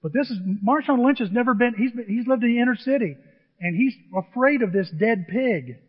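usual speaking voice

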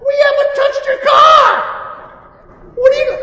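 very high, loud protest; exasperated shout. Retold or imitated. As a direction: yell back in played-up disbelief. Acting own exasperated shout back.

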